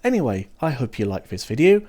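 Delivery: ordinary talk, nothing marked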